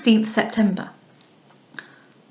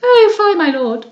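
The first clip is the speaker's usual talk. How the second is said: high, loud flirting protest; coquettish cry; 9 semitones higher, 6 dB louder than usual. Acted. Lady Louisa teases Lord Merton, crying out high. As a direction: cry out in mock offence. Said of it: "cried she".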